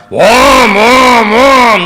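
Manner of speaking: loud, high vroom of a car engine; roaring engine imitation. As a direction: roar like a car with no exhaust. Imitates exhaustless car cranking up.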